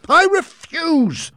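high, loud, slow defiant cry; exclaiming refusal. Acted. Heep refusing to hand over the papers, loud and high. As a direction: refuse outright. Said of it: "exclaimed Heep".

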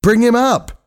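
high-pitched, pressing command; repeated urgent shout. A narrator's reading. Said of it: "he shouted again".

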